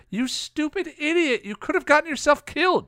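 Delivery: put-on high-pitched, angry girlish scream; acted scream. A narrator's reading. Girl slaps her protector for risking himself, screaming at him. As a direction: scream it high, scolding someone you feared for. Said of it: "she screamed".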